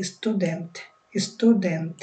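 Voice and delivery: স্টুডেন্ট with both t soft and dental, like ত; Swedish dental t. Shown as a demonstration. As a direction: model স্টুডেন্ট the Swedish way, tongue on the teeth. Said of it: "সুইডিশে উচ্চারণ হবে ত দিয়ে".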